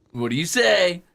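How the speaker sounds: ordinary talk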